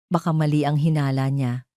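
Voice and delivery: ordinary delivery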